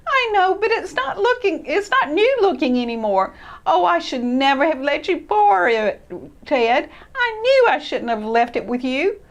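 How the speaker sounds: high, hurt mouse voice; regretful and upset; upset Christopher. Acted. Christopher regretting he lent the sled.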